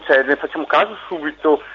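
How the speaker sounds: normal talking voice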